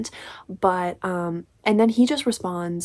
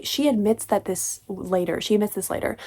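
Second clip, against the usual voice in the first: ordinary talk, nothing marked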